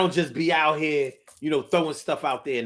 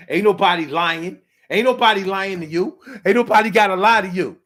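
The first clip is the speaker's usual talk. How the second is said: loud, raised-pitch denial; acted defensive protest; 4 semitones higher, 7 dB louder than usual. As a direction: protest louder with each repeat. Acts out a defensive quarrel.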